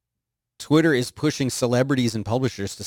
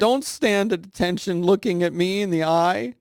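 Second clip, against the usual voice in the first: higher voice, playful scold; mock scolding; 8 semitones higher than usual. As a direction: tell it off in jest. Scolding Meerkat.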